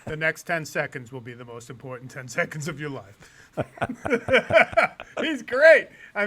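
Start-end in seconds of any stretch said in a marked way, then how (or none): 0.1-3.3 low, hushed, measured warning; grave film father. Retold or imitated. Film father warning his daughter, grave. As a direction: quote it low and soft, deadly serious.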